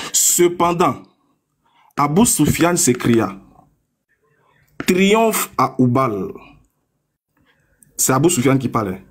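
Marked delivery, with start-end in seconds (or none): 4.9-6.3 slow solemn quote. Acted. Reads quoted cry slowly.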